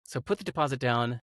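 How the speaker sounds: ordinary talk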